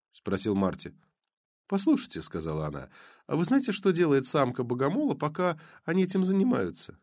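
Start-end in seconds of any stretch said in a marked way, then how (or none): 1.7-2.2 lightly voiced blonde. 3.3-7.0 lightly voiced blonde.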